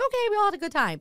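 high, singsong wrap-up; mock placating. Brushing off the date's thanks while leaving, mock placating. Retold placating reply to date.

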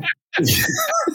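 high-pitched burst of real laughter; shrieking laugh. Real, not acted. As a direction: crack up helplessly. Cracking up at botched name.

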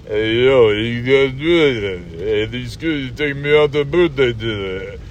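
thick, marble-mouthed tough-guy slur; Sylvester Stallone. Acted. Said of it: "That's what he said".

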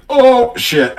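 high, loud "oh, shit" of panic; alarmed surprise. Retold or imitated. DM hit with a darts request, loud alarmed surprise. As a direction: blurt it out, caught off guard. Players ask to play darts.

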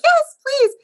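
high, loud imitated eagerness; hammed-up delighted yes; eager acceptance. Gallery owner's eager acceptance retold.

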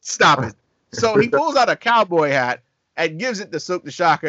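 usual speaking voice

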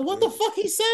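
high, laughing disbelief; baffled high squeak. Retold or imitated. Player baffled by the coach's call, laughing high. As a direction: squeak it out in total confusion. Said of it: "I'm like".

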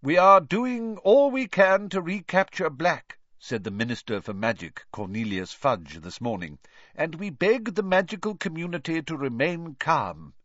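a lightly voiced pompous minister; Fudge's voice. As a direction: read it like an official statement. Fudge quoted in paper.

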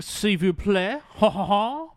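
high, mocking French teacher voice. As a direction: sneer it like a snooty teacher.

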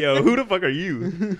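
high, slowed, alarmed challenge; mock alarm. Friend imagines meeting a literal-minded cannibal girl, mock alarm. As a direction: demand it like a stranger just freaked you out. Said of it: "I'd be like".

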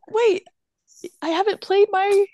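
put-on high eager kid voice; mock flustered boy; imitating a nervous kid, high; Arthur stalling.

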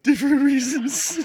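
high, loud squeal through real laughter; words bursting out in a laughing shriek; crying with laughter. Real, not acted. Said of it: "Sorry, I'm crying".

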